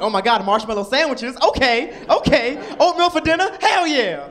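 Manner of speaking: put-on loud, high thrilled kid; child delighted by marshmallow sandwiches; excited kid. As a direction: cheer over cheap food.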